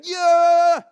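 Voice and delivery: loud high cheer; cheering crowd. Retold or imitated. Vicar playing the Palm Sunday crowd cheering Jesus, loud. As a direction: yell it like a football fan. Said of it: "some who were going".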